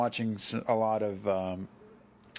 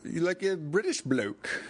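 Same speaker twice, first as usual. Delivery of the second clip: higher-pitched mock British bloke; British accent; 6 semitones higher, slower than usual. Imitating an internet conspiracy guy, British accent. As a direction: put on a playful British accent. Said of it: "some guy with a British accent".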